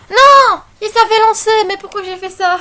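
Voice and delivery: loud, high-pitched wail of dismay; dismayed cry. Real, not acted. Tutorial maker realising she launched it by mistake, dismayed outcry. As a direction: cry out in real frustration at your own slip.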